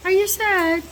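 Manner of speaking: high-pitched cooing question. Real, not acted. Mother asking her crying child about daddy, high cooing.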